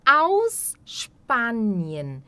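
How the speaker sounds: "Aus Spanien" drawn out, "Sp" as "shp"; very slow demo. Shown as a demonstration. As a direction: slowly model "Aus Spanien" with a "sh" start. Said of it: "teacher models phrase".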